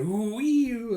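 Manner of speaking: mock siren, rising and falling; imitated fire siren. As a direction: wail like a passing fire truck. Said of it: "as the fire truck's coming by".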